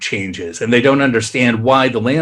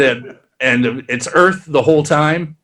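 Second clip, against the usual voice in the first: usual speaking voice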